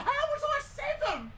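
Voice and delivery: high disbelieving squeak of a question; can't believe the result, pitch jumps high; incredulous. Real, not acted. Streamer shocked at placing seventh, high incredulous question.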